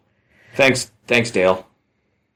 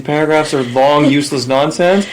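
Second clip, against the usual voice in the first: teasing; 6 semitones higher, 3 dB louder than usual. Real, not acted. Teasing partner.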